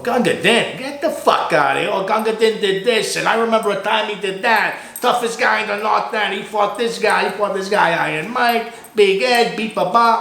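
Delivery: high, excited disbelief then bragging; amazed old-timers. Retold or imitated. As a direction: voice the old guys raving about him. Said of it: "They call him".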